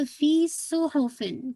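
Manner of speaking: फी सुहुफिन slow, with फी held; measured recitation. Shown as a demonstration. Teacher models the phrase, slow recitation. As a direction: stretch फी, then सुहुफिन evenly. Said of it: "फी को टू count".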